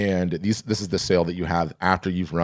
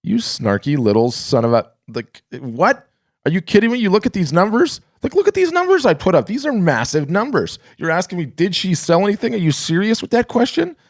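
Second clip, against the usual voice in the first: rising to a much higher, louder outraged rant; indignant outburst; 13 semitones higher, 5 dB louder than usual. Retold or imitated. Venting at a Facebook commenter, outraged high loud rant. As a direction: fire back in disbelief at the commenter, pitch climbing. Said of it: "I was like".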